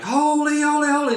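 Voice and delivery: high, loud acted cry; screaming emphasis. Angels crying out before the Father, screaming emphasis. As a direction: cry it out at the top of your voice. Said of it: "screaming at the top of your lungs".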